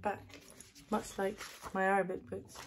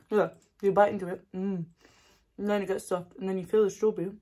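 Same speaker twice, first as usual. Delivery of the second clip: usual speaking voice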